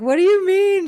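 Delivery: retold bewildered question, high and loud; baffled. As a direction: blurt it as a mock-baffled question. Acted baffled reply to friend.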